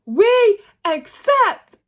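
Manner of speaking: high, loud and slow; slow, hammed-up declaration, high; mock dramatic accept. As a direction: draw it out grandly. Seth accepting, retold.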